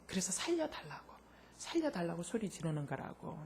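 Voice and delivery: very soft, near-whispered retold plea; hushed plea. She begs her friend to understand she is drowning, hushed plea. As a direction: plead under your breath for understanding.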